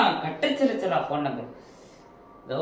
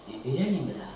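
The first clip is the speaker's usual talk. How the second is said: sad; 5 semitones lower, 8 dB quieter than usual. Acted. Quiet despairing whine.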